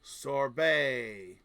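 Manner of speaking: loud drawn-out French pronunciation; Frenchified word, hammed up; French accent. Shown as a demonstration. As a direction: say it in an exaggerated French way. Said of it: "you become French all of a sudden".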